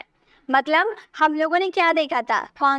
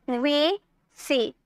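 normal talking voice

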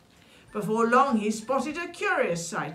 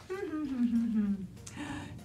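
soft, sly fox laugh; gloating storybook snicker; villainous chuckle; 3 semitones higher, 5 dB quieter than usual. A narrator's reading. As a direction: chuckle menacingly to yourself. Fox gloats over threatened mole.

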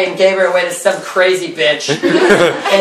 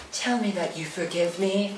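very soft, hushed plea; mock pleading; 2 semitones lower, 16 dB quieter than usual. Miss Havisham begging Pip's forgiveness, hushed mock plea. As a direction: beg it almost under your breath.

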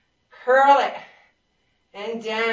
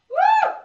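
a high, loud cheering whoop; excited whoop; 7 dB louder than usual. Real, not acted. Instructor whooping at the class mid-set, loud and high.

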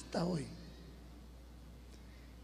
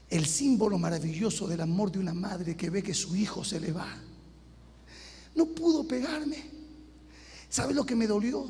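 ordinary talk, nothing marked

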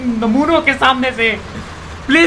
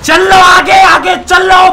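shouting at players; 5 semitones higher, 6 dB louder, much slower than usual. Real, not acted. Yelling at blocking players.

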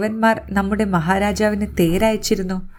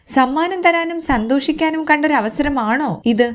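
higher, scolding rebuke; indignant retort; 8 semitones higher than usual. A narrator's reading. Maid rebukes the queen for celebrating, indignant and higher. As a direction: throw the question back with indignation. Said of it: "എന്നിട്ട് പറഞ്ഞു".

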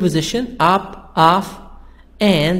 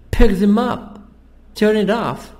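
"pick" and "up", "Turn" and "off" stressed, "them" and "it" unstressed; stress on verb and preposition; 3 semitones higher than usual. Shown as a demonstration. Teacher explains pronouns are old information, stressing "pick up", "Turn off" only. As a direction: lean on "pick", "up", "Turn", "off", keep "them" and "it" weak. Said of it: "We stress the verb".

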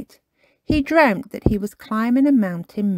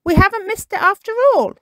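higher, fast happy rush; relieved delight; 13 semitones higher, 5 dB louder, faster than usual. Acted. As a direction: tumble out the good news gladly.

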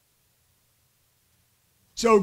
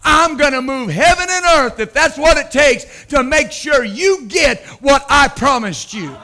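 voicing God; 6 semitones higher, 6 dB louder than usual. Pastor voicing God's promise to believers, booming shout. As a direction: declare it like God keeping covenant. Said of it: "God says".

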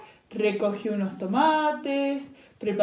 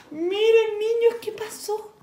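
excited; 12 semitones higher, 6 dB louder, slower than usual. Acted. Calls children, very high.

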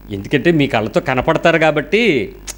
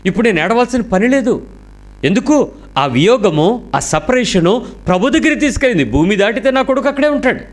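higher, building fervour; rising conviction; 6 semitones higher than usual. Real, not acted. Preacher voicing a mother's triumphant faith, rising.

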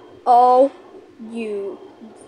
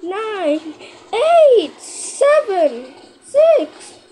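high, loud panicked counting; frantic countdown; 8 semitones higher, 4 dB louder, much slower than usual. Real, not acted. Gamer shouting out the final seconds, high and loud. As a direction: race the clock out loud.

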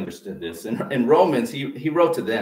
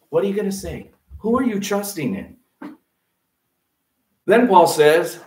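usual speaking voice

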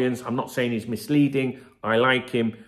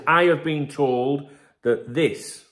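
slow and deliberate, leaning on have in I have been told; stress on have; much slower than usual. Shown as a demonstration. Showing viewers how a statement sounds, slow stress on have. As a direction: spell out the claim word by word. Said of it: "That's him making a statement".

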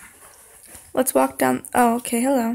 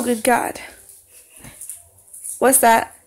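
ordinary talk, nothing marked

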